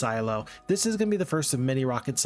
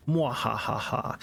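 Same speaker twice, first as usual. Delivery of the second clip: villain laugh; 2 semitones lower, much slower than usual. Pretend. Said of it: "in my hubris".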